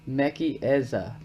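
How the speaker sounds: Mekieza said slowly, MEK-ee-EZ-a; book's pronunciation. Shown as a demonstration. Reading the concordance's pronunciation to viewers, Mekieza spelled out with a hard k. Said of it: "gives the pronunciation as".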